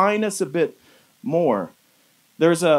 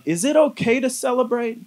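a raised, hesitant asking of the question; doubtful wondering; 6 semitones higher than usual. Retold or imitated. Preacher voices a believer wondering aloud, pitched up and unsure. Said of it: "in a position or a posture to wonder".

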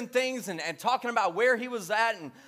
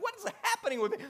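mock alarm; 12 semitones higher, 3 dB quieter, faster than usual. Pastor baffled at his own excitement, high mock alarm. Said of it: "I'm like".

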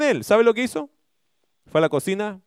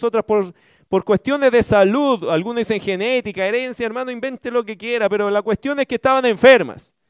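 high, loud preaching surge, pressing each phrase; full-force pulpit preaching, louder and higher; fervent preaching; 7 semitones higher, 4 dB louder than usual. Acted.

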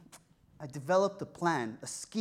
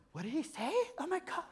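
imagined surprise; 9 semitones higher, 7 dB quieter, faster than usual. Retold or imitated. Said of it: "turn around and be like".

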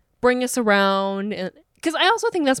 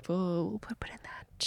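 hushed whisper; 13 dB quieter than usual. Real, not acted. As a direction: whisper it like a secret. Dodging the Egypt trip.

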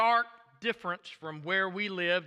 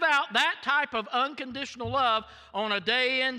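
rising, forceful sermon voice; preaching; 8 semitones higher, 4 dB louder than usual. Acted. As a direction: build it up to a preaching peak. Preaching builds to climax.

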